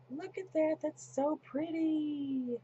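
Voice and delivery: high, drawn-out coo of admiration; lilting high voice, stretching the last words; delighted admiring. Real, not acted. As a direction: coo over something pretty. Admires own craft work mid-story.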